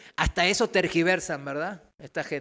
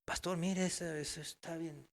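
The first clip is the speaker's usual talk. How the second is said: retold word to pastor; 13 dB quieter than usual. Speaker recommends a helpful newcomer to the pastor, quiet retold aside. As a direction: say it softly, as a private suggestion. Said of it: "le digo al profe".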